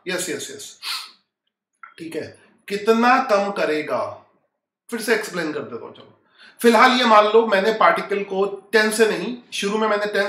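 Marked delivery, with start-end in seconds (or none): 2.7-4.3 slow, drawn-out teacher question; deliberately slow, word by word. Shown as a demonstration. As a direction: stretch out each word so students can follow. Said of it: "slow कर रहा हूं".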